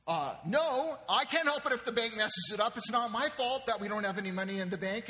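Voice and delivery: loud, high defensive outburst; upset husband. Acted. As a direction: protest hotly that you're being blamed. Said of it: "and he gets upset".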